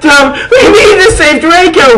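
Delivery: high, loud tearful plea; desperate begging. Acted. Goths begging Dumbledore, desperate and tearful. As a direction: plead through tears. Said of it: "we begged".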